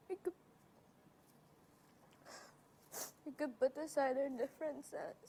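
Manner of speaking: fighting back tears while speaking; tearful. Real, not acted. Young woman close to tears, speaking of her distant dad. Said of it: "don't cry".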